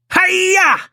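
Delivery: high shouted karate yell; battle cry. A narrator's reading. Raphael attacking the Krang, high shouted battle cry. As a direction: leap in with a fierce hi-ya. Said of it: "Raphael shouted".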